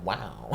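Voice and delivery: quiet, low echo of a drawn-out "Wow"; imitating own wow. Reactor mocking how they just said it, low drawn-out wow. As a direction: repeat "Wow" softly and low, mimicking yourself. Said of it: "Why did I say it like that?".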